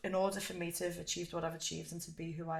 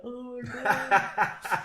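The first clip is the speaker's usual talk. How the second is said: overwhelmed; 6 semitones higher, 9 dB louder, much slower than usual. Retold or imitated. Coach says he's proud.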